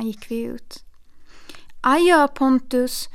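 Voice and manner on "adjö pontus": slow, louder stagey goodbye; theatrical farewell. Acted. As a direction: play the farewell for the aunt to overhear. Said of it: "det lät som teater". (teatraliskt adjö)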